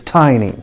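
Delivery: "tiny" as TY-nee, falling; correct pronunciation. Shown as a demonstration. Teacher telling learners the correct form, "tiny" as TY-nee. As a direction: say "tiny" with an eye vowel. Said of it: "Please repeat once again".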